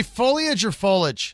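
"foliage" said in three syllables, then "foliage" in two; two pronunciations. Shown as a demonstration. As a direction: contrast FO-lee-ij with FO-lij in "foliage or foliage". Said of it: "'cause I've heard both".

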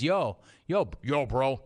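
ordinary talk

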